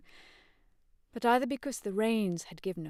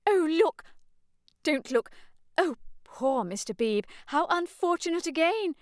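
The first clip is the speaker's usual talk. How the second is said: high, fussy scandalised matron; shocked fussy old lady voice; flustered mother; 10 semitones higher, 4 dB louder than usual. Acted. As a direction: fluster over the scene.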